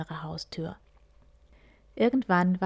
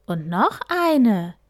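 admiring call; 3 semitones higher, 8 dB louder, slower than usual. A narrator's reading. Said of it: "rief Fuchs bewundernd".

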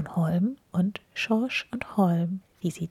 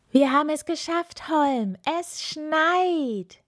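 high, jubilant call with stretched last word; proud shout; 10 semitones higher, 4 dB louder than usual. A narrator's reading. Little snowman celebrating the snow with his friend, proud shout. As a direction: cry it out in triumph. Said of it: "rief der kleine Schneemann stolz".

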